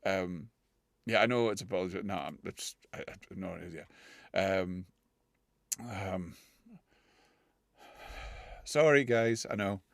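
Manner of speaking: normal talking voice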